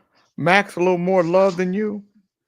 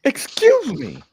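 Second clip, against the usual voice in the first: much higher, offended "Excuse me."; shocked lady; 10 semitones higher, slower than usual. Acted. The Walmart lady reacts to the rude question, shocked. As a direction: recoil in shocked disbelief. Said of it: "She says".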